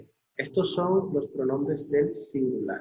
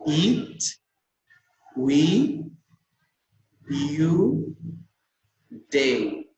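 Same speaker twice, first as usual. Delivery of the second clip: one pronoun at a time, long pauses; rising tune on each word, THEY falling to close; pronunciation drill; much slower than usual. Shown as a demonstration. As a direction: model each word, leaving room to repeat. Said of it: "van a seguir mi pronunciación".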